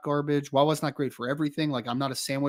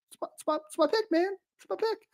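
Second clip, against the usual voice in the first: high-pitched insistence; faster than usual. Real, not acted. Podcast host defending his iced tea pick, squeaky high. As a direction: voice jumps much higher, almost squeaky.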